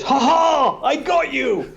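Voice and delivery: hammed-up triumphant gotcha; gloating interviewer. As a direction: crow it like a smug host catching you out. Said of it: "he stopped the interview and goes".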